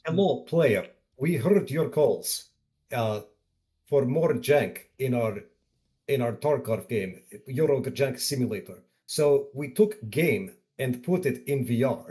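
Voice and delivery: put-on cheesy Russian game announcer accent; bad Russian accent. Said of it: "bad Russian accents on str-, on, uh, on stream right now?".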